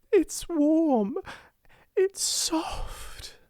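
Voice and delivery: very high, breathy, tearful wonder; almost sobbing. A narrator's reading. Girl clutching the dressing gown, almost sobbing. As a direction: gasp it out close to sobbing. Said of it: "she almost sobbed".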